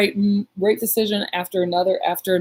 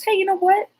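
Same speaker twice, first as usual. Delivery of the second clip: high, lilting retold invitation; perky suggestion; 14 semitones higher than usual. Mom proposing a dinner outing to daughter, perky. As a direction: pitch it up like a bright idea striking. Retelling mom's sudden suggestion.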